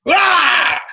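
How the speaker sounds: loud frustrated shout; retold angry yell; mock angry yell. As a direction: bellow out your anger at the car. Furious man chunking his keys.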